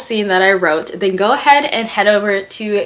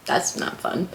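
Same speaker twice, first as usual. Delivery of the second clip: softer, breathy under-breath remark; quiet aside; 8 dB quieter than usual. Real, not acted. Reacting to a tragic story line, hushed aside. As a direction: murmur it sadly to yourself.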